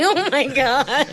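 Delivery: slow, laughing through the words; laughing disbelief. Real, not acted. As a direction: giggle it out, embarrassed. Laughing at the revelation.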